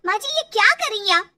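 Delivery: high, loud, distressed protest; upset protest. Acted. Daughter-in-law protesting the rough massage, loud and high. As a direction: object loudly, voice climbing high. Mother upset over crying baby.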